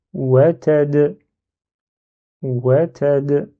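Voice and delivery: WATAD said slowly, final d released with a rebound; bouncing final d. Shown as a demonstration. Teacher reading a word with soukoun, echoing final d. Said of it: "avec un rebond qu'on avait dit".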